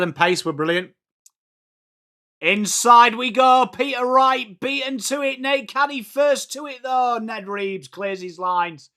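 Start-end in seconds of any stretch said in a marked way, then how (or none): 2.4-8.9 higher, louder play calling; live play-by-play. Real, not acted. Streamer calling the footy game live, commentator voice. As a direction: call the action as it happens.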